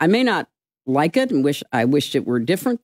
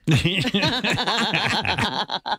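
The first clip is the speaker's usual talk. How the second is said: laughing; 3 semitones higher than usual. Real, not acted. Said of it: "laughs at own cough joke".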